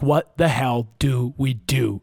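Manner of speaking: slow, word by word; slowly spaced, helpless question; retold dismay. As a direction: drag out each word, at a loss. Retold panic at the table.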